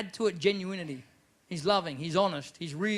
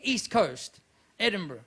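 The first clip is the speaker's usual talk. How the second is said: ordinary delivery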